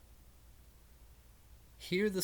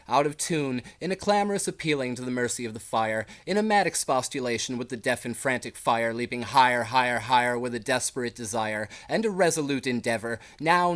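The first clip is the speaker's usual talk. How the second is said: louder, urgent and driving, shriek-like climax; alarmed loud recitation; 8 dB louder than usual. Acted. Reciting the terror of the alarm bells to listeners, loud urgent climax. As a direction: build the panic of the alarm bells.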